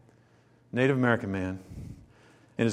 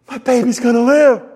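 higher and louder, beaming with joy; joyful news; 7 semitones higher, 11 dB louder than usual. Retold or imitated. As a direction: burst out with the good news. Mother's joyful news retold.

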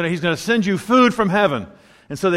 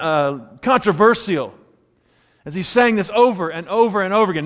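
normal talking voice

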